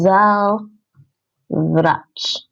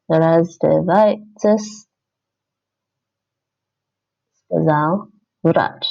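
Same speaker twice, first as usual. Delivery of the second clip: even slower, drawn-out reading; slower dictation; 2 semitones lower, slower than usual. Shown as a demonstration. Learner typing the answer, reading very slowly. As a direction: stretch each word as you type it. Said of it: "second take while typing".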